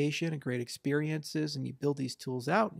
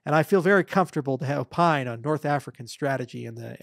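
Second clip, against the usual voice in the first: pompous know-it-all voter; 6 dB louder than usual. Retold or imitated. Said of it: "somebody's like".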